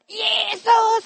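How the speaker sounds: very high, loud desperate cry. Acted. A beggar shouting over the crowd to Jesus, desperate scream. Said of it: "Er schreit nur noch lauter".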